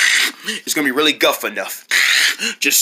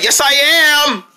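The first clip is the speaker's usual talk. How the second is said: defiant insistence; 9 semitones higher, 4 dB louder, much slower than usual. Acted. Insisting against friend.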